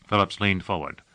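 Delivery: usual speaking voice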